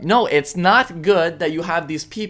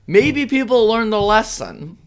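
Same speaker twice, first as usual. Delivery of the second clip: usual speaking voice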